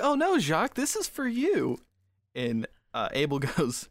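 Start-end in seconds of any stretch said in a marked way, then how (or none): 0.0-1.8 slightly high, quick and teasing; lightly voiced [name]. [name] teases a rattled Jacques in a lightly raised voice. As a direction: tease him gently about being out of it.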